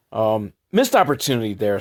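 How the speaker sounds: ordinary talk, nothing marked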